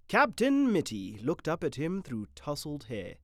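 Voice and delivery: usual speaking voice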